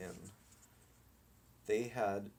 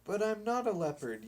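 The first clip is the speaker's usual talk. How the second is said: higher, louder, fast protest; yelled protest; 9 semitones higher, 5 dB louder, faster than usual. Acted. Dog protests to the boys, yelled fast. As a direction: blurt out the objection. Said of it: "yelled Candito".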